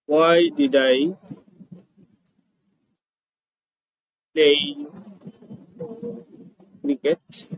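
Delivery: slow, spaced-out modelling of the question; drawn-out teacher model with pauses; slow, word by word. Shown as a demonstration. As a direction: say each word apart, slowly for the class. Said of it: "teacher models word by word".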